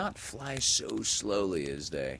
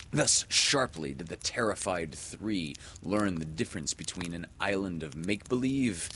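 ordinary delivery

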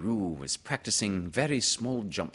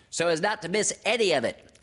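high, loud small-animal voice; eager chime-in, pitched up; Piglet; 11 semitones higher, 6 dB louder, faster than usual. Acted. Little friend chiming in, high and eager. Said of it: "said Piglet".

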